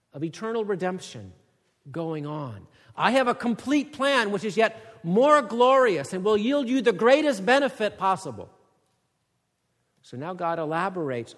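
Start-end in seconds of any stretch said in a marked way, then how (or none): 3.0-8.5 loud, high proclamation; God's promise. Acted. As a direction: promise it with grandeur. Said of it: "paraphrased God's promise".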